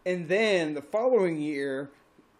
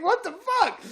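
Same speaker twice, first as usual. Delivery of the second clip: shocked; 14 semitones higher, 5 dB louder than usual. Retold or imitated. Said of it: "I'm like".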